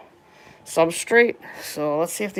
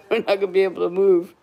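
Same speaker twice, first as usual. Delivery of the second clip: ordinary talk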